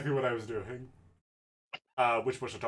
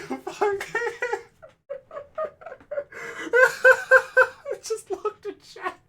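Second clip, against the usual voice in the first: laughing; 7 dB louder, much slower than usual. Real, not acted. Cracking up at chat.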